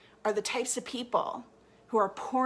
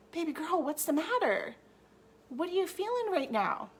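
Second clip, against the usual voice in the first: tender mother; 5 semitones higher than usual. Pretend. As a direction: coo gently to an upset child. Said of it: "we'd be like".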